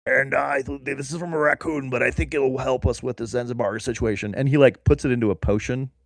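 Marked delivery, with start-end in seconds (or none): 0.1-4.3 a hint of a raspy old politician; RFK Junior voice. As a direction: muse over his grim collection. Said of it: "He's like".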